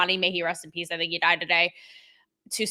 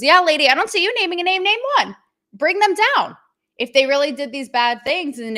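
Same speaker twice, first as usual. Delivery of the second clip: indignant outburst; 7 semitones higher, 7 dB louder than usual. Real, not acted. Scolding Hollywood actress, rising indignation.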